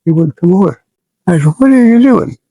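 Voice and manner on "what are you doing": high, louder call across the pad; exasperated yell. Retold or imitated. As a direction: shout it at a friend who won't come. Yelling at laughing soldier.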